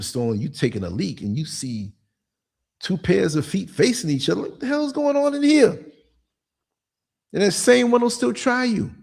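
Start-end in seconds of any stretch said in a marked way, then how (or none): 4.4-5.7 startled. Retold or imitated. Startled in bathroom stall.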